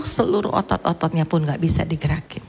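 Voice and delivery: ordinary talk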